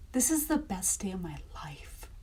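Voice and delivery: a soft breathy whisper of glee. Retold or imitated. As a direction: whisper it like a happy secret. Retelling her own joyful thought.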